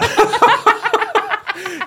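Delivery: very high, loud laughter; real uproarious high laughing; big laugh. Real, not acted. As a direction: crack up at full volume. Both laugh at the claim.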